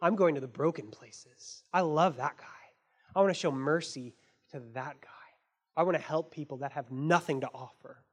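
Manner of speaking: low, soft, caring insistence; tender reassurance. Retold or imitated. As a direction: gently insist on mercy. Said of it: "Jesus was like".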